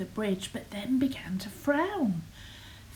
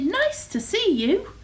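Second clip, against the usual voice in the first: high hooted greeting; swooping storybook dinosaur hello; friendly hoot; 9 semitones higher, 4 dB louder than usual. A narrator's reading. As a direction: hoot a welcome, pitched up. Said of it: "and they hooted".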